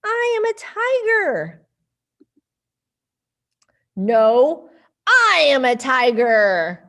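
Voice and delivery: put-on lively storybook voices for kids; reading to children. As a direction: read it playfully to little ones. Reading picture book to kids.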